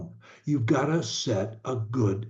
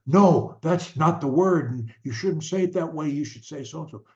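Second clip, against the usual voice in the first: higher, insistent mock correcting; scolding correction; 5 semitones higher than usual. Coach acts a parent correcting a child, mock scolding. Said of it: "rather than say".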